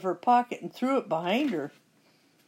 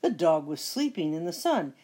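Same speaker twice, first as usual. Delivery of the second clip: ordinary delivery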